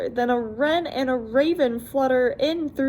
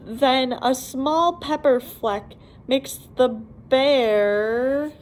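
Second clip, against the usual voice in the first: slow suspense. Acted. Suspense before sneeze.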